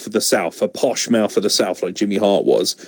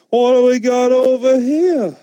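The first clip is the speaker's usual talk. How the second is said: mock Enzo; slower than usual. Mocking Enzo's promo voice.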